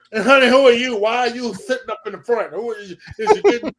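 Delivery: high, loud grilling; bossy diva demanding answers; imitating the diva. Guest imitating the star grilling a wedding guest. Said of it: "She was like".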